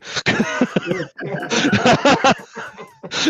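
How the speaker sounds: high, airy, breath-only laughter; real breathy laugh. Real, not acted. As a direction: laugh silently, all air. Laughs at the story's punchline.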